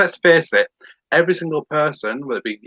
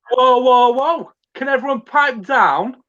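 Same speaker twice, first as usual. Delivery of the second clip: compere calming the crowd; 11 semitones higher than usual. Shown as a demonstration. Compere hushing a noisy crowd in a high showman voice. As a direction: playfully settle a rowdy audience. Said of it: "I go like this and go".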